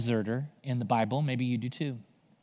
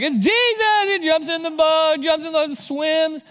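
very high, excited hollering; Forrest Gump; 12 semitones higher, 4 dB louder than usual. Acted. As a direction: keep up the stretched, high-pitched mock yell. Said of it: "Peter, just like Forrest Gump".

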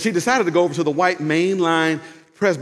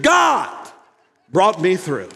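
loud, high shout on GOD; bold public praise; 3 semitones higher, 3 dB louder, much slower than usual. Acted. Daniel thanking God publicly, bold acted shout. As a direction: shout the first word, then bring it home. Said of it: "No, he said".

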